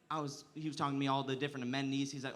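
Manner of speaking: ordinary talk, nothing marked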